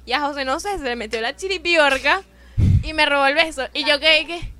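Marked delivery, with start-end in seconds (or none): 2.8-4.5 louder, indignant disbelief; outraged what at the stolen kiss; shocked. Retold or imitated. Guest retelling a stolen kiss to the hosts, shocked and loud.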